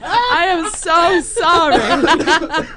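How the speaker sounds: high, slow apology through laughter; laughing apology. Real, not acted. Apologizing to co-hosts for the terrible accent, laughing hard.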